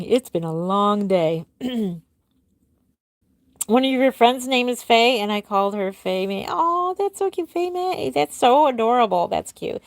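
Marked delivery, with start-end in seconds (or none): 6.5-9.3 high delighted coo. Real, not acted. Reacting to a viewer's doll name, high delighted coo. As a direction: squeal it out, way up high.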